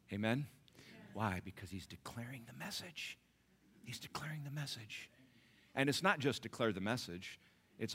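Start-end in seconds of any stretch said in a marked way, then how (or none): 1.4-3.2 soft. Shown as a demonstration. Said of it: "repeated as a whisper". 3.8-5.1 a hushed repeat for emphasis; whispered. Shown as a demonstration. Preacher whispering the point back to the congregation. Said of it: "repeated as a whisper".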